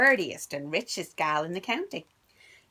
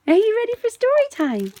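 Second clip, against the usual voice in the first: excited greeting; 9 semitones higher, 11 dB louder, faster than usual. Real, not acted. Teacher calling children to story time, high and loud singsong.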